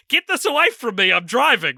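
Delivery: flustered driver pushing it away; Shaggy voice. Retold or imitated. Shaggy annoyed while driving, loud high voice. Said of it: "And Shaggy's like".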